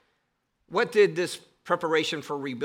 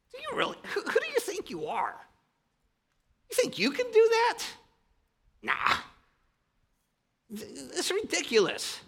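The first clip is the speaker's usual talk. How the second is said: much higher, sneering mock voice; mocking enemy; 12 semitones higher than usual. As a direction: scoff at them like a taunting enemy. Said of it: "They mock, saying".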